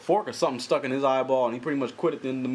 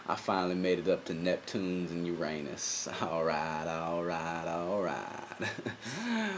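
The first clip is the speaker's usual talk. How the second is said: much softer, lower drawl; Matthew McConaughey impression; 4 semitones lower, 5 dB quieter, slower than usual. Plugging his movie, in a soft low McConaughey drawl. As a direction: murmur it low and lazy. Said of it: "my Matthew McConaughey".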